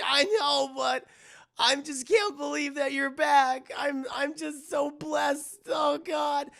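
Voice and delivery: put-on high, wailing drunk mother; mock crying. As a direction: blubber with relief, hammed-up and loud. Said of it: "alcoholic of a mother is crying".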